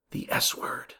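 mock horrified whisper; scandalised whisper. Child reporting a classmate's swearing, scandalised whisper. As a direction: whisper it like a forbidden word.

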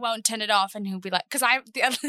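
ordinary talk

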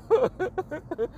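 high burst of laughter. Real, not acted. Laughing after his own joke.

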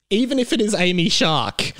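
normal talking voice

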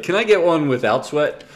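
cheeky wise-ass ask, laughing. Retold or imitated. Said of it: "Mister Wise Ass".

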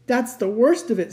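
a touch of a groan; deep groan. A narrator's reading. Fawn about to confess to Lucy, groaning. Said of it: "said Mister Tumnus with a deep groan".

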